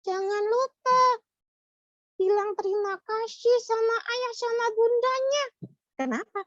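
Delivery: squeaky little puppet, earnestly advising; a small puppet telling kids to be gentle; high child puppet voice. Acted. Puppet voice of Mulia.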